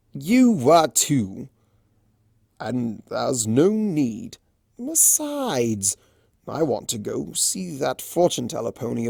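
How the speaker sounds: slow, sly trickster drawl; Discord, slow and teasing. Acted. Discord teasing Fluttershy, slow and sly. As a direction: tease her and change the subject.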